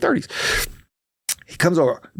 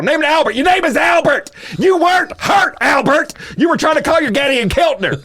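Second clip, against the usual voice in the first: high, loud taunting yell at Albert; shouting the man down, then breaking into a laugh; mocking shout; 12 semitones higher, 4 dB louder than usual. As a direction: jeer at him, pitch way up. Mocking the driver by name.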